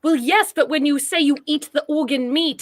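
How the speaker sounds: usual speaking voice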